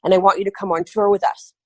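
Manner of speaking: usual speaking voice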